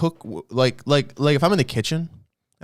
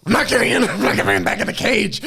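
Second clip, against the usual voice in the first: high, loud mock panic-rage; frantic shouted refusal, pitched way up; freaking out; 11 semitones higher, 4 dB louder than usual. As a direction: play a hammed-up freak-out. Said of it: "He freaks out".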